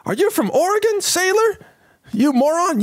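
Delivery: high, barking mock drill officer voice; mock navy officer. Host mocks clueless recruit as a shouting officer. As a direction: bark at the sailor like a cartoon officer.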